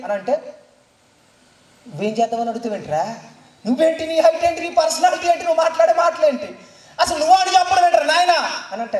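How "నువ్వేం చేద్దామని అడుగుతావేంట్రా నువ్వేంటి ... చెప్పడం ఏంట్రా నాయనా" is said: a king mocking a boy, building to a shout; scornful king scolding. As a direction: rebuke the upstart boy, rising to a shout.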